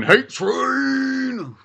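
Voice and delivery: high, drawn-out whooping call; mock train cry. Host hypes a pile-on of hate, high playful cry. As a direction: wail it out like a train whistle.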